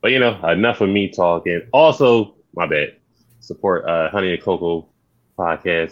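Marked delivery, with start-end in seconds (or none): none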